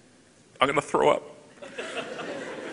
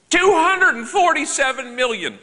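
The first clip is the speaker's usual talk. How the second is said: excited reveal; 9 semitones higher, 3 dB louder than usual. Real, not acted. Revealing a startling number.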